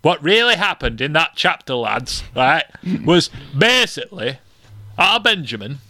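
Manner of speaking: put-on northern accent. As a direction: explain it to the lads as a put-on northerner. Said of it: "Why is he northern?".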